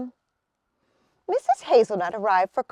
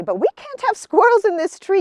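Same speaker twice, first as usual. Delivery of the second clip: higher, louder, fast firm refusal; 9 semitones higher, 8 dB louder, faster than usual. Acted. Missus Acorn firmly refusing Mister Squirrel, louder. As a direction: shut the squirrel down. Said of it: "said Missus Acorn firmly".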